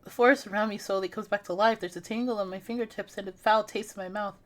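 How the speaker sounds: steady, hushed first-person narration; story narration. Acted. Gamer reading her horror game aloud, hushed narration. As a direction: read the horror scene like a storybook.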